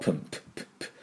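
voiceless little puffs of "プップップッ"; P sound. Shown as a demonstration. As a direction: pop each "プッ" as a bare, soft P. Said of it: "Pの音".

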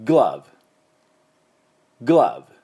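グラブ slow, short a, final v; correct English glove. Shown as a demonstration. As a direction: model グラブ slowly with short a and v. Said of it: "正しい発音いきましょう".

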